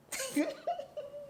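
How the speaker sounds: high, breathless burst of laughter; hard laugh. Real, not acted. Vlogger to viewers, laughing hard at her own story. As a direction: crack up laughing at herself. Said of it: "I'm laughing so hard".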